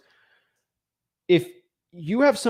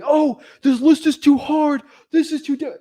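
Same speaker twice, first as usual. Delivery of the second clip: high, slow, whining voice, much higher and louder; whiny mock complaint; 11 semitones higher, 7 dB louder, slower than usual. Coach mocking a student who finds the list too hard, whiny mock voice. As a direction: whine it like a student making excuses.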